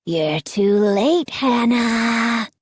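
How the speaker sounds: slow, low, mocking sneer. A narrator's reading. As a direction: sneer it with cruel pleasure. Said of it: "he sneered".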